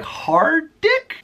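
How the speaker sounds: high, drawn-out puzzled question; baffled soccer mom. Retold or imitated. Soccer mom reading his name tag, high puzzled question. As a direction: stretch it out, high and confused.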